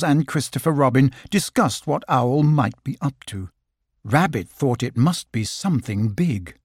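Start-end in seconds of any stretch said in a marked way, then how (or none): none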